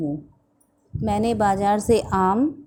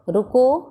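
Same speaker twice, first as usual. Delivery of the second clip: रुको alone, higher and rising, then a pause; jeda setelah रुको; 4 semitones higher, 4 dB louder, slower than usual. Shown as a demonstration. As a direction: stop right after रुको, as at a comma. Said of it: "comma".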